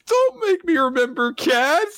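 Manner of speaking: mock-sulky and sweet; cute girl. Asa telling Denji not to remind her of cats, high cute. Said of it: "she goes".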